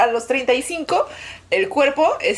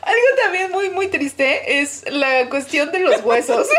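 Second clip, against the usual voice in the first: laughing; 9 semitones higher than usual. Real, not acted. Can't stop laughing, near tears.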